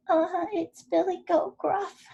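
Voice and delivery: squeaky little goat. A narrator's reading. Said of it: "squeaked the smallest goat in his little voice".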